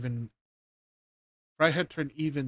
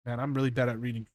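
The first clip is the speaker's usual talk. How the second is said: fast aside; much faster than usual. Real, not acted. Aside about own reading.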